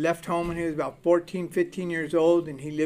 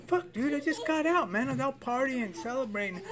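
higher, softer, fading whine of a caught parolee; higher-pitched mock excuse tapering off; whiny excuse; 8 semitones higher, 7 dB quieter than usual. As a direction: plead your innocence like a busted kid. His excuse to the officer.

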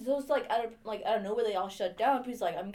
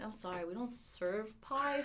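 slow deadpan pizzeria reply; 8 dB quieter, slower than usual. Retold or imitated. Pizzeria worker to a caller asking for a pie, deadpan. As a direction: drag out the polite refusal. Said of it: "I'm like".

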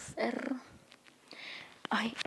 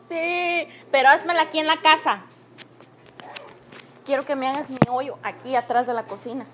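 mom voice; 4 semitones higher, 10 dB louder than usual. Pretend. Mom's replies voiced.

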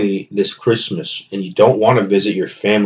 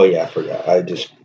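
ordinary delivery

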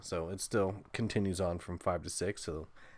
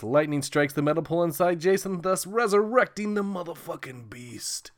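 mock-epic horror narration; dramatic trailer voice; 7 semitones higher, 4 dB louder than usual. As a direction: boom it like a movie trailer.

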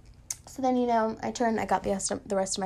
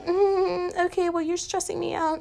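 passive-aggressive mutter; 7 semitones higher than usual. Retold or imitated. Passive remark to pushy girl.

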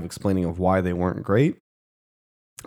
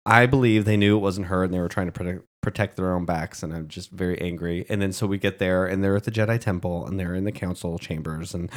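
usual speaking voice

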